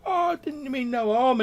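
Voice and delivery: a caught lad begging off; pleading youth. Acted. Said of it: "this youth's like".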